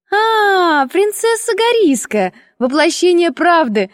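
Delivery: put-on high, merry girlish greeting; cheerful exclamation. A narrator's reading. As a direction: hail a friend gaily, pitched well up. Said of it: "воскликнула она весело".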